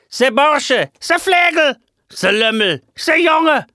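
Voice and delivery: furious yelling teacher. Acted. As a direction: bellow insults at the impostor. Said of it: "brüllten unaufhörlich aufeinander ein".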